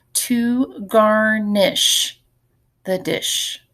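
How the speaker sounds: very slow, loud demo of "garnish"; slow syllable demo. Shown as a demonstration. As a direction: draw out "garnish" so the two syllables can be heard. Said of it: "Garnish. Two syllables".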